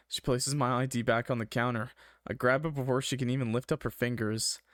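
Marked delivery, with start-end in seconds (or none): none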